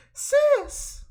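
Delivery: very high, loud squeal of a call; alarmed sister. Acted. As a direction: cry out for your sister. Sister calls out in game.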